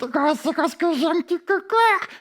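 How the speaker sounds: high-voiced timid creature; scared little dog. Little dog admitting fear to the host, high voice.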